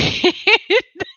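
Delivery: high laugh breaking into the sentence; real, uncontrollable high giggle; helpless laugh. Real, not acted. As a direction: crack up mid-sentence. Cracks up while reading chat.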